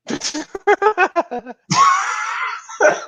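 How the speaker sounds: big high burst of laughter; howling laugh at the joke. Real, not acted.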